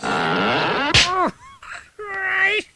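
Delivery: strained agonized cry from lifting; pained cry. Acted. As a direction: yelp out in sudden back pain. Straining at weights, back pain.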